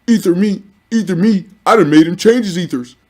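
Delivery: high taunting imitation of hecklers, singsong; mocking the channel idiots. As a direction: sneer it like a begging troll. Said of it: "idiots who don't have no views on the channels who keep poking at me".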